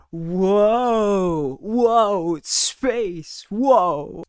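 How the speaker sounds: high, loud, wide-eyed gasps of wonder; amazed whoa. Pretend. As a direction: gawk at outer space. Pretends to fly through space.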